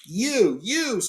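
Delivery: high furious cry, rising; cried out. Acted. Thorin turns on Bilbo, high angry cry. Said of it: "cried Thorin".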